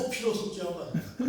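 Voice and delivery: Gyeongsang 커피로 sung through laughter; giggling while repeating 커피로; laughing mimicry. Shown as a demonstration. 웃으며 커피로 흉내. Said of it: "이렇게 들린대요".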